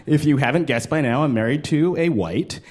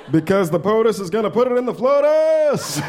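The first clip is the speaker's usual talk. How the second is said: higher, a hint of Obama cadence; a light Obama impression; mock Obama; 6 semitones higher than usual.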